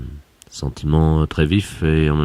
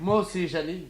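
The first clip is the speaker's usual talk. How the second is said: very high, much softer sighing reply; mock tender reply; 11 dB quieter than usual. Boss coos his love back to Janine, very high and soft. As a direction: coo back like a lovesick boss.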